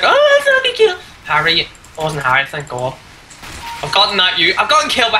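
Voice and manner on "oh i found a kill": high, squeaky, smug mock voice; mocking kill-stealer. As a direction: mimic the smug thief sarcastically. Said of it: "and is like".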